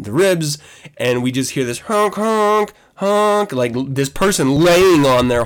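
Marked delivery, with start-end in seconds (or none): 1.8-3.5 sustained, high horn honks; imitated car horn. Man imitating a driver laying on the horn, honking. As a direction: hold each honk like a car horn.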